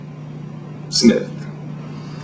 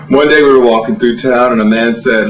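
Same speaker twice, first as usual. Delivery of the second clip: usual speaking voice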